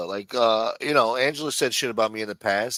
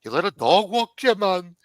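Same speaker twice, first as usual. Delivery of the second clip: mocking quote; 7 semitones higher, 4 dB louder than usual. Mocking what others say.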